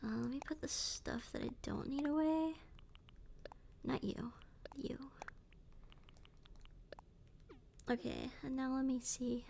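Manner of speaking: soft whisper. Real, not acted. Quiet whispered game asides.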